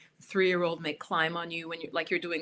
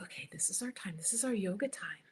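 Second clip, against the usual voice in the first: hushed whisper; 8 dB quieter than usual. Shown as a demonstration. Parent whispering to kids to start yoga time, whispered. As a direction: hush the room with a soft whisper. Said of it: "I would be like".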